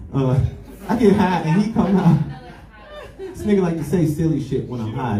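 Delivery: ordinary delivery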